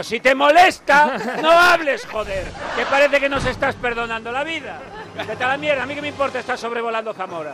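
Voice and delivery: angry rant. Retold or imitated. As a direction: yell it at the captain in fury.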